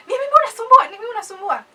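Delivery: high, loud mock complaint; overdramatic parent. Parents scolding over a small thing, high and overdramatic. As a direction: blow it up into a big deal, high-pitched. Acting out parents making a big deal.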